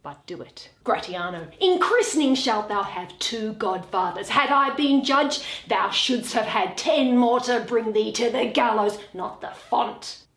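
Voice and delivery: Shakespeare read aloud, every part voiced in turn; theatrical reading of a courtroom scene; dramatic play reading. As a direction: read the scene like a stage performance. Reads all parts of the play aloud.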